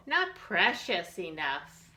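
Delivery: ordinary delivery, nothing marked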